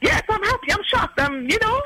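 high, giddy excited reply; squealing with pleased shock. Retold or imitated. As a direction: gush with retold excitement. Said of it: "I'm like".

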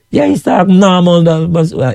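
high-pitched, rapid Georgetown Guyanese talk. Retold or imitated. Talking as if to G T people, quick Georgetown accent. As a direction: slip into quick Georgetown patter. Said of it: "like I talk to G T people".

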